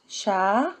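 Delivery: ordinary talk, nothing marked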